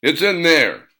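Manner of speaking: curt, loud snap; snapped. Acted. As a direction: bite off the words. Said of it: "Duke snapped".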